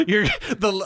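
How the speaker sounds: high, loud words through laughter; laughing. Real, not acted. Host reacting to the guest's face, laughing out the words. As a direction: crack up mid-sentence.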